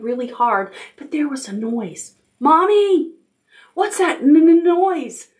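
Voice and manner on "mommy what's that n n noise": high, loud little owl squeak. A narrator's reading. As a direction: squeak and stammer for mother. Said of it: "squeaked Little Owl".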